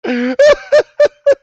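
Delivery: loud, high real laugh; squealing laugh. Real, not acted. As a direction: crack up helplessly. Cracks up at the accent.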